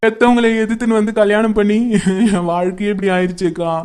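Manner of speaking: wailing lament broken by sobs; crying. Acted. Betrayed wife tells her friend of her parents' warning, crying. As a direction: sob out each phrase, drawing out the endings. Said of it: "first அழாத நிறுத்து".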